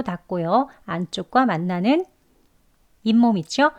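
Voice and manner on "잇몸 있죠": usual speaking voice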